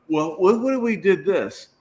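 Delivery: imitating own hopeful pitch; retold eager suggestion. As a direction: float a silly idea eagerly. Retold pitching of stupid idea.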